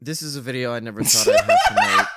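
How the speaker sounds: fast, loud fake-solemn YouTuber apology; loud mock YouTube apology; mock apology video. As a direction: open it like a rushed, overwrought apology video. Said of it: "apology video opener".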